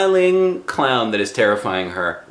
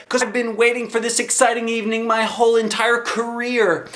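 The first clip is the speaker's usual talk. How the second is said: excited; 13 semitones higher than usual. Acted. Excited child at carnival.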